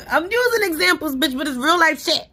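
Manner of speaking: loud, high-pitched, fresh off a laugh; amused outburst. Real, not acted. Laughing outburst while defending her examples.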